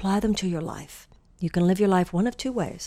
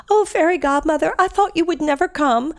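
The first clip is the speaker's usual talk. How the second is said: high, louder Cinderella voice; 9 semitones higher, 5 dB louder than usual. Retold or imitated. Cinderella retold to listeners, higher and louder. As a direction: call out up high like the relieved princess.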